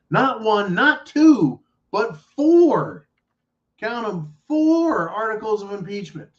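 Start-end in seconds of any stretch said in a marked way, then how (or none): 0.1-3.1 each number punched harder and higher; building emphasis. Real, not acted. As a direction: count them off, piling on the sarcasm. Sarcastic emphasis on impeachment count.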